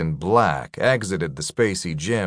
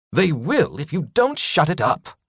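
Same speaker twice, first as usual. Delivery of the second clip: higher, irritated snapping; sharp snap; 9 semitones higher than usual. A narrator's reading. Markov snapping at Chester over the captive. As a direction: bite the words off impatiently. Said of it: "Markov snapped".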